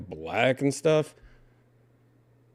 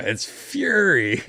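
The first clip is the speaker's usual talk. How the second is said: excited; 7 semitones higher, slower than usual. Real, not acted. Excited reaction to video.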